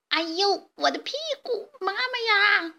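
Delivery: high squeaky chick voice moaning in pain; comic chick groan. Acted. Bruised chicks groaning at their sore bottoms, high whine.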